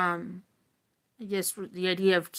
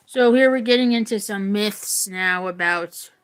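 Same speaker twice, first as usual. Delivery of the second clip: usual speaking voice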